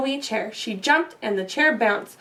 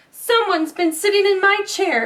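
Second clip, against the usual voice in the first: a hint of outraged papa bear; exclaimed; 7 semitones higher than usual. Papa Bear finds his chair used, lightly exclaiming. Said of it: "exclaimed Papa Bear".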